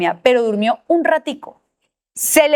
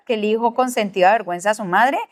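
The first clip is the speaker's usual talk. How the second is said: ordinary delivery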